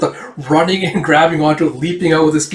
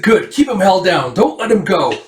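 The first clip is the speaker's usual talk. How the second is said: raised-pitch command to helpers; acted excited instruction, pitched up; urgent order; 5 semitones higher than usual. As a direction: keep them on task mid-struggle. Villager orders captive held down.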